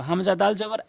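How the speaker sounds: ordinary delivery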